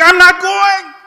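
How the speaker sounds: loud, high stubborn refusal; old man clinging to the pipe, loudly refusing to go. Retold or imitated. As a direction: dig your heels in and cry it out.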